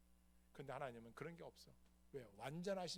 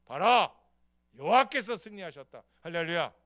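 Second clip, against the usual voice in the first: loud victorious cry; triumphant shout; 3 semitones higher, 22 dB louder than usual. Retold or imitated. David holding Goliath's head up, shouting in triumph. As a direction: hold up the head and roar it. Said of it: "탁 머리채를 들고".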